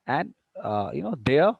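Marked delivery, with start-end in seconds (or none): 1.2-1.5 "there" with a downward glide; "there" falling like an ending; falling intonation. Shown as a demonstration. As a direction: drop the pitch on "there". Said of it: "falling intonation".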